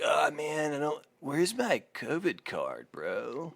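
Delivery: high, soft, slow stoner hippie whine; lightly voiced hippie lost in a haze; gnarly hippie. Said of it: "gnarly hippies showing up".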